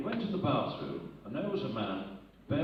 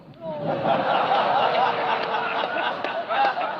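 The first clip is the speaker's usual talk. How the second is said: loud helpless laugh; 13 semitones higher, 13 dB louder than usual. Real, not acted. Laughing at own punchline.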